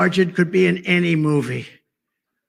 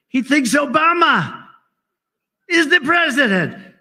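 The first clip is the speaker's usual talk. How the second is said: slow, much higher mocking voice; mocking Lou Dobbs; 9 semitones higher, 4 dB louder than usual. Imitating Lou Dobbs, high slow mockery. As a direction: lift it high and mock him slowly. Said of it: "Look at the great Lou Dobbs".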